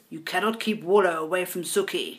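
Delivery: lightly attempted Dutch accent, measured delivery. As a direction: have a go at a mild Dutch accent. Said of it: "that's my best Dutch accent".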